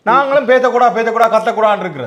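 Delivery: loud, high-pitched telling-off; exasperated scolding. Acted. Housemate telling off the newcomer who hates cold, exasperated scolding.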